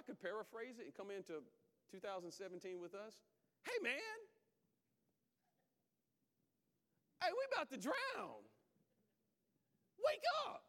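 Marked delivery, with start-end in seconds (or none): 3.6-10.6 high, loud panicked street voice; frantic modern disciple. Acted. Disciples yelling at sleeping Jesus, high loud panic. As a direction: shout him awake in a panic. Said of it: "That's how it sounded".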